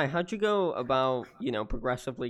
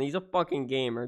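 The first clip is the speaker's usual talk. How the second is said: usual speaking voice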